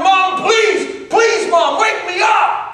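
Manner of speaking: high-pitched pleading holler; screaming and hollering. Acted. Burning dreamer begging his mother to wake him, hollering. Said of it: "he's screaming and hollering".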